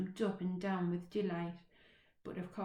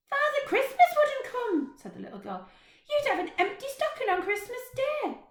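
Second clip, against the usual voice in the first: put-on high, chatty little girl, a bit bossy; 10 dB louder than usual. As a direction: play a cheeky small girl. Little girl's voice in story.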